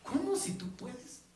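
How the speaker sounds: slow, gently incredulous question; mild voicing of Jesus, slow and puzzled. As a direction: let him sound amazed at the doubt. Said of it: "Jesús le dijo".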